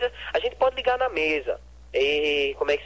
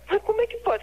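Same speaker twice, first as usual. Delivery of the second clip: espantado. Real, not acted. Owner shocked by the barbecue story.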